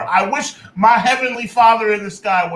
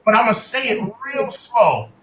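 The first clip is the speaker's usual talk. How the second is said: slow, spelled-out delivery; real slow; slower than usual. Real, not acted. Fan explaining to the chat, deliberately slow. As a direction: say it slowly so it sinks in. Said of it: "let me say this to you real slow".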